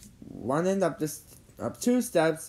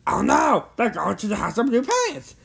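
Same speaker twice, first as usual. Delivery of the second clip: loud, raised cry of alarm; alarmed shout; 8 semitones higher, 6 dB louder than usual. A narrator's reading. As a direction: shout a warning to your friends. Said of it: "She shouted".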